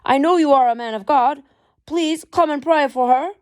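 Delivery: put-on high, loud pleading in a light Irish accent. Irish husband begs Hudson to pray for his wife, pleading. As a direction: beg the man of God in an Irish lilt. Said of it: "The man pleaded in a thick Irish accent".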